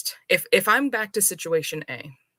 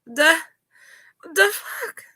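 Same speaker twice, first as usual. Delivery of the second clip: mock shocked yell, much higher and louder; indignant outburst; 12 semitones higher, 4 dB louder than usual. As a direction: blurt it out, outraged and incredulous. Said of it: "and I'm just like".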